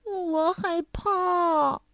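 a scared little monkey hanging his head, barely daring to speak; timid little monkey. A narrator's reading. Little monkey tells mother he is scared, timid and slow. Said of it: "他就低着头说".

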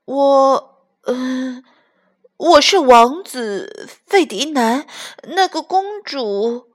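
faltering, high and slow; nervous, words trailing off; hesitant stammer. Acted. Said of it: "他说不下去了".

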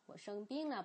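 ordinary talk, nothing marked

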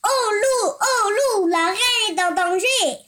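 loud, high alarmed yell from the palace guard; guard shouting. Acted. Palace guard yelling at Lou to protect the queen, loud alarmed shout. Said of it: "mais le garde se mit à hurler".

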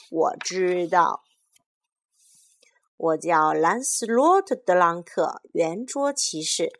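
booming knight entrance. Acted. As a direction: announce yourself like a proud knight. Knight introduces himself.

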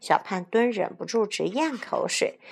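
plain reading voice

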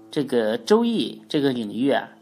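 ordinary talk

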